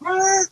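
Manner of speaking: high, soft bewildered "ah" sound; a mind-blown little gasp, retold; baffled exclamation. Listener baffled by the voices story, high bewildered gasp. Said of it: "my head's going".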